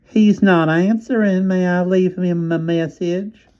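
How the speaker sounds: drawn-out Southern operator voice. A narrator's reading. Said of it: "The nasal voice came again".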